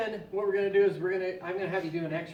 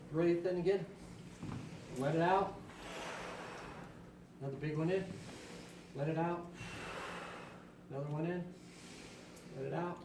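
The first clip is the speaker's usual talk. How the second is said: calm breathing guide; 2 semitones lower, 8 dB quieter than usual. Shown as a demonstration. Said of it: "guiding breathing".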